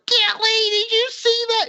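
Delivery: very high-pitched mock scream; screamed impression, way up high; Dread's excited screaming. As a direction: imitate an excited caster screaming to a co-caster. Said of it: "he starts screaming".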